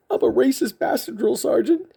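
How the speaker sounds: high-pitched mock recruit answer; recruit reporting. Recruit confesses to his funny sergeant, high-pitched reporting voice.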